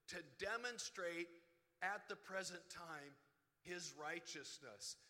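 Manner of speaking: slow, loud pulpit proclamation; scripture reading. Acted. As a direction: proclaim the verse slowly from the pulpit. Reads Romans aloud from pulpit.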